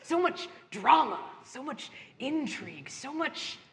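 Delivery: low, soft, courtly villain voice; quiet menacing host; butler farewell. Acted. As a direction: murmur it low like a sinister host.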